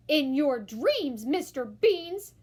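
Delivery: slow, loud mama retort; a loud, slow motherly no; mama's retort. A narrator's reading. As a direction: draw it out, firm and unimpressed. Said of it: "said Mama".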